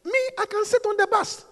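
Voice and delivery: usual speaking voice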